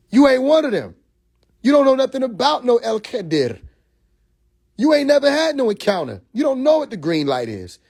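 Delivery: high, heated challenge; indignant rant. Acted. Calling out officials who dodged his question, indignant. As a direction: push it up in pitch, scornful. Ranting at the administration.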